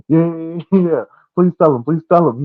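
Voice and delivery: high, loud eager pleading. Retold or imitated. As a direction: beg eagerly, grinning. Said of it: "He's like".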